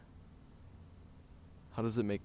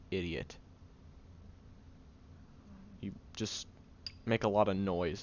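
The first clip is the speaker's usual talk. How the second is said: ordinary talk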